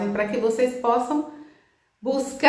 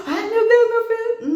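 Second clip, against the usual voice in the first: mock despair; 10 semitones higher, 4 dB louder than usual. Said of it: "Eu não fiquei no desespero".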